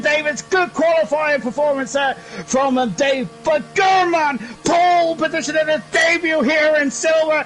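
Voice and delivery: high, shouting live play-by-play; excited race commentary. Real, not acted. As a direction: call the pole lap at full excitement, pitch way up. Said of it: "Right, I need to calm down".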